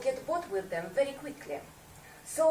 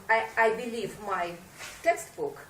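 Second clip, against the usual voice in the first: ordinary delivery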